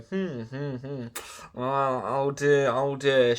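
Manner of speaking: slow, pondering mechanic hums, lightly voiced; imitating baffled mechanics stroking their beards; mock puzzled mechanics. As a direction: drag out the hmms and tut oh dear, slowly. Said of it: "looking at each other going".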